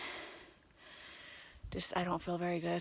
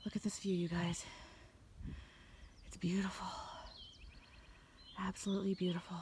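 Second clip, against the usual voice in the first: awed whisper; 12 dB quieter than usual. Real, not acted. Awed by mountain view.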